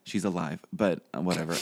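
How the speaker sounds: usual speaking voice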